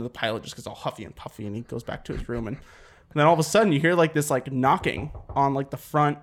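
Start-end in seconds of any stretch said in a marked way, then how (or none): none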